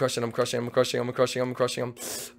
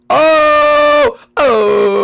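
loud, high shout of dismay; outraged oh; loud shocked cry; 11 semitones higher, 11 dB louder than usual. Real, not acted. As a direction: yelp at the loss. Losing the race, loud exclamation.

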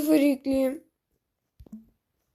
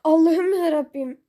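louder, higher cry of despair; exasperated cry; 4 semitones higher, 7 dB louder than usual. Real, not acted. Gamer cries out at the game in loud exasperation. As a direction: throw it up to the sky, fed up. Exasperated outburst mid-match.

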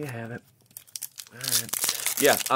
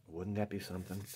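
softer echo of Wouldn't that be something; Wouldn't that be something said again under the breath; quiet repeat; 3 semitones lower, 14 dB quieter than usual. Real, not acted. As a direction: muse it again, much softer.

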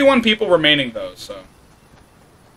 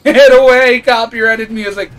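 yelling; 5 semitones higher, 6 dB louder than usual. Real, not acted. Yelling at music.

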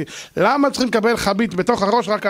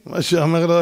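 ordinary delivery

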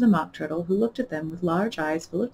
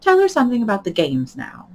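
decided tone; 4 semitones higher, 7 dB louder than usual. Acted. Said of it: "in a very decided tone".

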